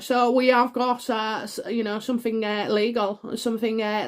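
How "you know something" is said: ordinary delivery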